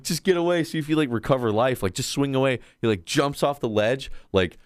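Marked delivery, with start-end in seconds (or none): 0.0-2.6 urgent advice. Retold or imitated. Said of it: "I was like".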